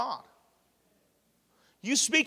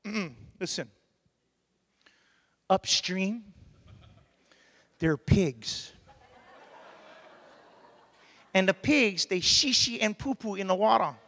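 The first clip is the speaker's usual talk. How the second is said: auntie explaining. Retold or imitated. Auntie warning him about pigs upstream, lightly voiced. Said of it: "She goes".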